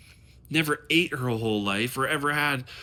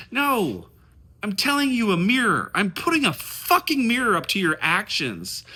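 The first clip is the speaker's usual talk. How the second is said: heated and loud; 6 semitones higher, 4 dB louder than usual. Real, not acted. Heated rant at the listener.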